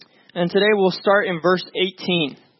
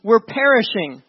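higher, drawn-out cry for rescue; panic-stricken plea; 3 semitones higher, slower than usual. Acted. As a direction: plead with Jesus in acted panic. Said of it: "in panic stricken".

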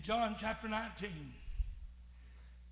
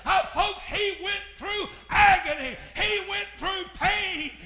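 full-force preaching; 6 semitones higher, 9 dB louder than usual. Acted. Preacher shouting to the congregation about the cross, full force. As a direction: preach it out over the organ.

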